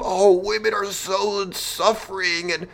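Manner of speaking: high, sarcastic whine about suffering; mock them with a whiny put-on voice; rising into a high, mock-tearful whine. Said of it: "They would have you believe".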